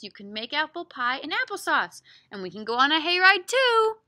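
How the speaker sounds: loud, animated storybook read; lively story voice with small woofs; reading to children. Acted. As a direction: project it to little listeners. Reads picture book aloud.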